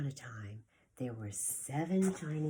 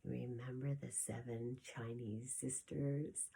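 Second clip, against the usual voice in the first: plain reading voice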